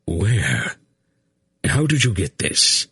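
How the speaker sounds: the shock of finding the hidden passage; startled amazement. A narrator's reading. Belgarath reads the page and asks Geryon, startled and amazed. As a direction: pitch leaps up in astonished questions. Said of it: "and suddenly he gasped".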